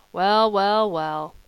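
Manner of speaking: a hint of a brash film mogul; lightly voiced American producer. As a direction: drawl it out sizing him up. Mister Cram, American film man.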